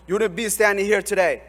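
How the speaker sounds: usual speaking voice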